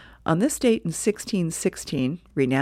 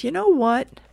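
put-on higher, bossy girl voice; Lucy's voice; 8 semitones higher, 4 dB louder than usual. Lucy coming up to Charlie, higher and bossy. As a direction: demand his attention like Lucy.

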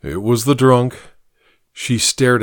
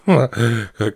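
a tipsy little giggle; drunken giggle. Acted. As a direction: giggle to cover a hiccup. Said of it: "covered it with a giggle".

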